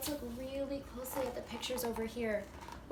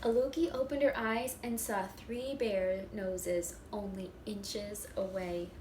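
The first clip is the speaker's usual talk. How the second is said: lively, louder read-aloud with bear voices; animated storybook reading; 4 dB louder than usual. A narrator's reading. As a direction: act it out for the first graders. Reading picture book aloud.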